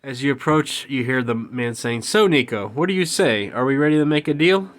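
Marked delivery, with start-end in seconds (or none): 2.0-4.7 pushy businessman. Acted. Villain businessman pressing Nico.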